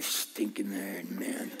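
very low, slow, muttered grumble; grumbling complainer. Retold or imitated. A grumbling believer complains to the Spirit in a very low, slow grumble. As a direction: growl it out under your breath, dragging the words. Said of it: "it reads like this".

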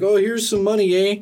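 put-on high, bouncy Canadian accent. Rick Moranis handing over money in a Canadian accent. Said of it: "every stereotype of Canadians".

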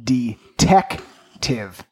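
very slow drawn-out title; dramatic slow reveal. Shown as a demonstration. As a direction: stretch it out for the big reveal. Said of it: "reveals pun title".

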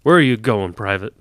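loud barked question; sharp shouted challenge from a specialist. Acted. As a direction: shout it across the motor pool. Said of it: "A specialist was the one who shouted".

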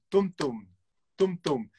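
beating out tum, tum like a heartbeat, first beat accented; heartbeat imitation. Shown as a demonstration. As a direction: mimic a steady heartbeat with tum, tum. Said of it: "como el latido del corazón".

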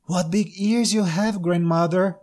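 put-on high, wide-eyed little girl; amazed young girl. Girl marvelling at the wolf.

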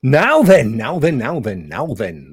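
Jimmy Savile. Retold or imitated. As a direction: drawl the catchphrase like a TV host. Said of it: "That was Jimmy creeping in there".

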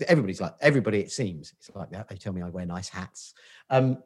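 ordinary talk, nothing marked

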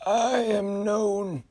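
high, very slow uncanny voice; uneasy otherworldly figure; eerie high voice. Acted. Frederick names himself.

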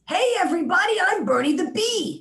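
a brash Brooklyn bee shouting hello; Bernie the Bee voice. Pretend. Puppet bee greeting the listeners in a high, loud Brooklyn voice. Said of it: "Bernie the Bee talks like this".

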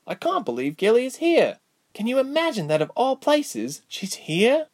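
high, delighted disbelief, lightly hushed at most; mildly thrilled confiding with a smile; smiling whisper back. A narrator's reading. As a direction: marvel at it in a high voice. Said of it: "whispered back with a smile".

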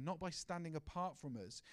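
normal talking voice

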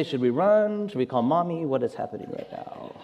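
hushed voice, fading away; furtive aside. Retold or imitated. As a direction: imitate scared kids conferring under their breath. Frightened girls confer in side glances.

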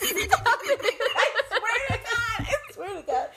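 high-pitched laughing disbelief. Real, not acted. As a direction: swear it while cracking up. Laughing after dirty quote.

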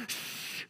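soft unvoiced hush; soothing shush. God hushing a busy child, soft shush. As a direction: hush them gently to slow down. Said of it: "God's gonna slow you down and go".

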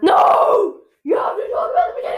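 high, fast desperate shout. Real, not acted. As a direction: yell for him to stay. Brother leaving, shouting him back.

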